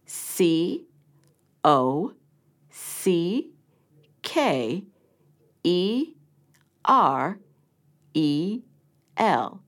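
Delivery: each letter said slowly and separately; spelling out letters. Shown as a demonstration. Teacher spelling a word for the pupil, letter by letter. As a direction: spell it out for dictation. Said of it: "Write the letters".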